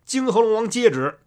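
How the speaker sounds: high, loud summons from above; calling out. A narrator's reading. A voice from mid-air summons the Dragon King, calling out. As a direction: proclaim it loudly, pitched high. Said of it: "就听得半空中叫道".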